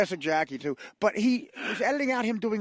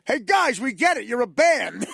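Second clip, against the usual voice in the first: annoyed comic scolding the band; 4 semitones higher, 6 dB louder than usual. Retold or imitated. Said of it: "He goes".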